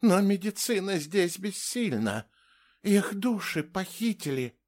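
high-pitched, helpless lament; plaintive. A narrator's reading. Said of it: "жалобно произнесла Ингрид". (жалобный высокий голос)

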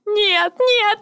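«нет, нет» высоко, в рыданиях; оба «нет» истерично, с падением; toddler tantrum. Acted. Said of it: "Истерика охватила меня". («нет, нет» в истерике, высоко)

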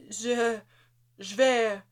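halting, broken-off stammer in fear. A narrator's reading. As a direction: stumble over the words, terrified. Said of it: "bégaya Jonathan". (bégaiement apeuré, hésitant)

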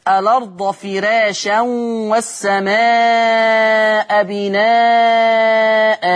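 very slow chanted recitation with long held final vowel; Quran recitation. Shown as a demonstration. Teacher reciting the example verse, slow and chanted. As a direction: chant the verse, stretching the last word. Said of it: "نيجي على سبيل المثال الآية".

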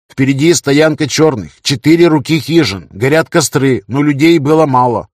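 urgent scout report. Acted.

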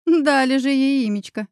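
plain reading voice

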